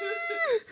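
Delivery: very high drawn-out squeal; high mock squeal. Friend retells her reaction to heckling, high squeal. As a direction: squeal in mock horror at the catcall.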